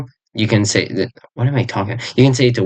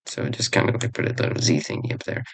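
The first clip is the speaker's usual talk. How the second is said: fast whispered aside; 9 semitones lower, 10 dB quieter, faster than usual. Real, not acted. Teacher murmuring an aside while writing, whispered. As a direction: drop to a whisper, quickly.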